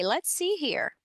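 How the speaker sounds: normal talking voice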